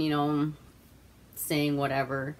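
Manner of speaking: ordinary talk, nothing marked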